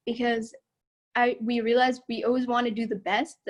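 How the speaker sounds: ordinary talk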